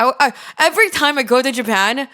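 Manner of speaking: usual speaking voice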